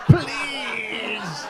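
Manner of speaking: high mock desperate plea; mock begging. Comic begs doctors to do both procedures together, mock pleading. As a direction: beg for it in mock despair. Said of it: "It's like".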